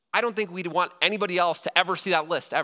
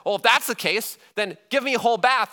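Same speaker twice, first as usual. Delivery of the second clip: higher and louder, suddenly all in; eager Peter; 8 semitones higher, 7 dB louder than usual. Retold or imitated. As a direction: blurt it out in an eager rush. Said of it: "And he says".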